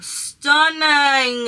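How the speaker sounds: loud, drawn-out exclamation; big, high, gushing praise; gushing admiration. Real, not acted. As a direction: stretch it out in showy delight. Gushing over finished eye look.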